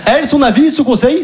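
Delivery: ordinary talk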